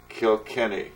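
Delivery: normal talking voice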